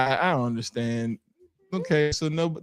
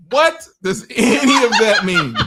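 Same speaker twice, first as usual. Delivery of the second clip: louder, higher, laughing hard; loud laughing question; 7 semitones higher, 7 dB louder than usual. Real, not acted. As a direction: shout it while cracking up. Repeats question cracking up.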